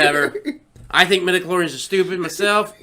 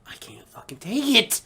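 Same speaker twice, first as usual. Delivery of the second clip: mock whisper; 3 semitones higher, 7 dB quieter than usual. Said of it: "And she's like".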